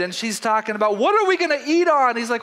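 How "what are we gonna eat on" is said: higher, louder, indignant servant; livid servant. Retold or imitated. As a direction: play the upset servant complaining loudly. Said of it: "she's livid".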